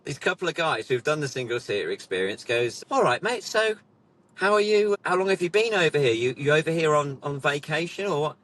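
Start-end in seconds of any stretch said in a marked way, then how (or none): 2.9-8.4 high-pitched imitation of a curious customer; raised, matey questioning voice; chatty British bloke. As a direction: imitate a bloke quizzing a foreign visitor. Retold customer questions the fake American.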